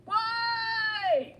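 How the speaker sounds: high, loud, stretched-out wail of protest; anguished why. Real, not acted. Narrator protests the dog's death, high loud wail. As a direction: cry out at the unfairness. Narrator reacts to dog dying.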